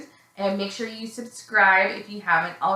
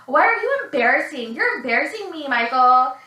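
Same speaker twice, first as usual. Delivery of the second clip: lightly mocking high-pitched nagging; imitated scolding mommy; 5 semitones higher, 3 dB louder than usual. Girlfriend embarrassed by her boyfriend, mock mommy reproach. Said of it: "literally mommies him".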